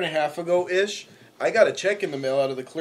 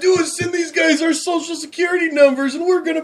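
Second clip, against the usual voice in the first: much higher, louder gullible pitchman voice; spam commercial guy; 12 semitones higher, 4 dB louder than usual. Retold or imitated. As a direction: ham up a naive old TV ad. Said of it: "Like that first spam commercial".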